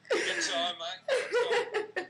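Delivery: very high, loud words wheezed out through laughing; can hardly speak for laughing; helpless laughter. Real, not acted. Cohost laughs over Aussie banter.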